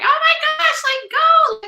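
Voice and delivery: very high, loud cheering mom; loud sports mom. Retold or imitated. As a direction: yell it from the bleachers like an over-eager mom. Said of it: "you're the loud mom who's like".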